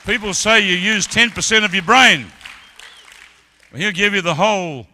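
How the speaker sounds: loud, high-pitched sermon climax; full-force preaching. Acted. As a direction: preach it out, building to the roar.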